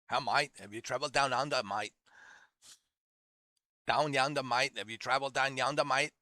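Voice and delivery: bad outback Aussie twang; terrible Australian accent. Mock outback local asking about travel, terrible Australian accent. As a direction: imitate a country Australian, badly. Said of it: "my terrible Australian".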